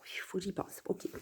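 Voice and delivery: barely voiced whisper; whispered note to self. Real, not acted. Reminding herself about the cigarette, whispered. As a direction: whisper it under your breath as a reminder.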